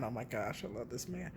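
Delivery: I love this man, dropped almost to a murmur. Real, not acted. Repeats the phrase softer.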